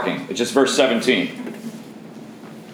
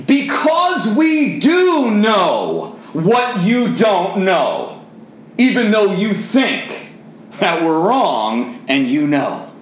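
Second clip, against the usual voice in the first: preaching at full force; 6 semitones higher, much slower than usual. Real, not acted. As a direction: drive it home like a preacher.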